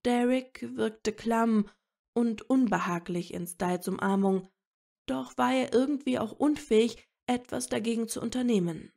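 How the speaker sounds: plain reading voice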